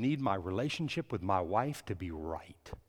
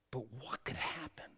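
whisper; 10 dB quieter than usual. Real, not acted. Hushed rhetorical question.

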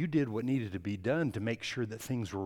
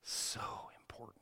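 whisper; 12 dB quieter than usual. Real, not acted. Intimate aside to congregation.

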